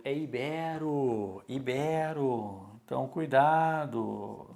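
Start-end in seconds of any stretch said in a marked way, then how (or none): 0.0-2.7 ibero drawn out slowly, stress on be. Shown as a demonstration. Teacher to students, slow correct ibero, stress on be. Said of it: "não é íbero".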